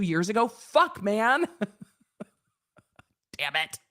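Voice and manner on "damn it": usual speaking voice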